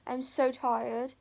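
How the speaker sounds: usual speaking voice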